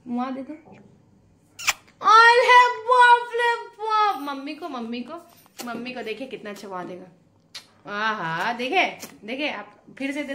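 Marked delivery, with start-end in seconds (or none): 2.0-4.2 much higher, louder, very slow cry of alarm; exaggerated oh-my-god at the dog's bite; playful shocked wail; mock alarm. Real, not acted. Dog bites, mock alarm.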